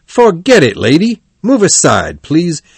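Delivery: impatient ticket seller; brusque dismissal. A narrator's reading. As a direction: send a bothersome customer away.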